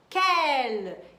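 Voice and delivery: loud, stretched "quel", said "kel" with a clear l; model pronunciation. Shown as a demonstration. Teacher introducing the next question word, loud clear model "quel". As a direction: punch "quel" and hold it for learners. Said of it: "Repete aí comigo".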